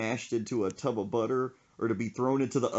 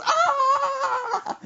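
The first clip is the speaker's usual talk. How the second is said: loud high shriek of fright; mock terrified scream, very high; imitated scream; 8 dB louder than usual. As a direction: scream like you just got spooked. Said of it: "And screams and goes".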